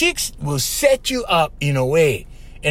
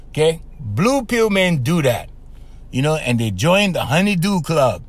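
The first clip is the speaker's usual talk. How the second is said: ordinary delivery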